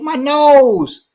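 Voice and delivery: put-on high, whiny little-girl voice; toddler voice. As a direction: play a hurt two-year-old. Toddler answers dad.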